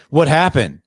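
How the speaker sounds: sharp, loud demand of the wrong way; demanding what happened. Shown as a demonstration. Dad demonstrating the wrong reaction to his son, loud demand. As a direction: show the interrogating version, pushed hard. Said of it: "I didn't say".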